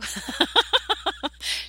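real high breathy laugh; laughing at asking a caller for a review. Real, not acted. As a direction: burst into a high airy laugh. Laughing at her own request.

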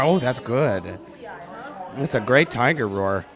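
ordinary talk, nothing marked

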